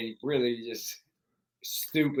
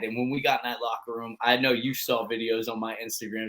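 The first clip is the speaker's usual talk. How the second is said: normal talking voice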